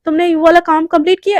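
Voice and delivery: loud, sharp scolding question; angry question. Acted. Boss angrily asking the assistant about work, loud. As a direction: demand it angrily. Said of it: "एकदम गुस्से में बोलती है".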